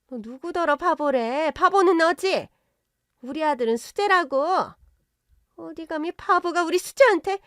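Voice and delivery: high, bristling outrage; indignant outburst. Acted. Mother-in-law bristling at the daughter, high indignant retort. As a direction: snap back in offended disbelief. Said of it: "사위와 사부인이 동시에 발끈하더군요".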